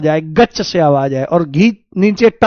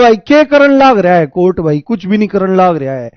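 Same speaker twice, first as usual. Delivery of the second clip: put-on Haryanvi accent. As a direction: mimic a Haryana student in broad Haryanvi. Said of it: "Haryana वाले बच्चों को पढ़ाता हूं".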